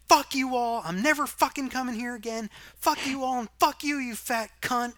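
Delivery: high, loud mock screaming; imitated screaming rant. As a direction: act out an enraged customer yelling at the whole room. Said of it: "the guy who's screaming".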